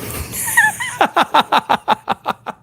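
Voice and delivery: high squeal dropping into cackle; staccato villain cackle; mocking laugh. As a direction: laugh scornfully in descending bursts.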